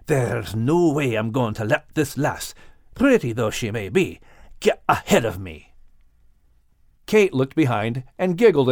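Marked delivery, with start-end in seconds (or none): 0.1-5.7 low growl. A narrator's reading. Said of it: "Max was growling under his breath".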